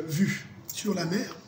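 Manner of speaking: ordinary delivery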